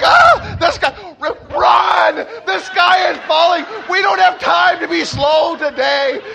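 high, full-volume frantic shouting; hammed-up storybook hysteria; mock panic. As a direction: scream and urge everyone to flee. Panicked birds fleeing and shouting.

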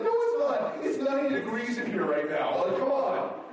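playful mock exasperation at the heater; exasperated retort. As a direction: push back in disbelief, laughing. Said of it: "It's like".

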